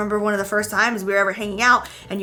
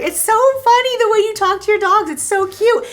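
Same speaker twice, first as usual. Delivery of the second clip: retold gushing; 11 semitones higher, 6 dB louder than usual. Quotes boyfriend gushing about dog talk.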